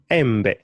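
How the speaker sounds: "embe" said em-beh, short e sounds; Swahili e vowel. Shown as a demonstration. Teacher modelling the Swahili word for mango. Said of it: "try and pronounce this word".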